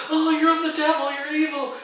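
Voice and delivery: very high, loud mimicked panic; mock horror. Teacher mocking his student's fear, shrieky mock horror. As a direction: wail the accusation in hammed-up alarm.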